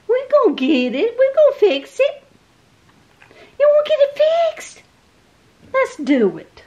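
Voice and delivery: very high reassuring coo. Real, not acted. Owner reassuring her shaking dog, very high cooing.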